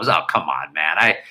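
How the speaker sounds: ordinary talk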